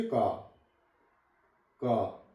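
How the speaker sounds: कार said as का, no r at the end; silent r. Shown as a demonstration. Teacher shows students का without r. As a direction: end का on an open vowel, dropping the r. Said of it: "आर silent है इस पे".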